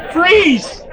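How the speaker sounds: sharp high call of freeze; called command. Real, not acted. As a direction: stop the whole room with one word. Calling out to freeze the crowd.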